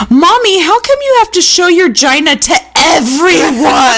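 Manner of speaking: high, loud-mouthed child imitation; piping mock kid voice; loud little daughter. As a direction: blurt it out like a three-year-old. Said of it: "my daughter replied loudly".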